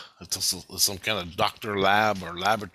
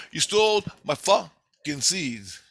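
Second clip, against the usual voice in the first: slow, higher-pitched retold accusation; emphatic accusation; 6 semitones higher, 3 dB louder, slower than usual. Intruder accuses scientist of stealing, slow emphatic accusation. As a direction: drag out each word accusingly.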